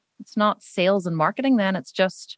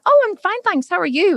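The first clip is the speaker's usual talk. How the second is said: high, louder cheery reply; bright high reply; 11 semitones higher, 5 dB louder than usual. Retold or imitated. To the pharmacy cashier, high cheery reply. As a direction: chirp it back brightly. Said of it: "And I went".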